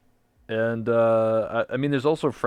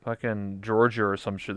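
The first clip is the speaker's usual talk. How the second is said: normal talking voice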